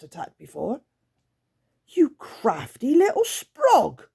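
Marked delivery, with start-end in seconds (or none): 1.9-4.1 slow, much higher, louder creature spitting words; spat. Acted. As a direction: spit the insult at the girl. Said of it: "Grunt spat".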